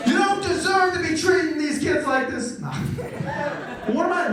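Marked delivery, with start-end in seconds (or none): 0.1-2.6 mock impassioned school speech, pitched up; hammed-up motivational address; motivational speaker. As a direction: address a school hall with playful fervour. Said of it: "I should be a motivational speaker".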